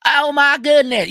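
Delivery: high, loud gossiping local; mock local gossip. Mocking Kentucky locals at KFC, a high loud gossip voice. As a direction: squeal it like a small-town busybody. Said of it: "So, eh".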